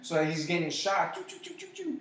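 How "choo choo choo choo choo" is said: high imitated gunfire sounds; gunshot noises. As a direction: spit out mock bullet noises. Imitating gunshots hitting the Tick.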